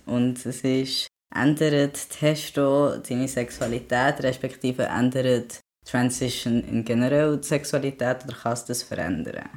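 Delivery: usual speaking voice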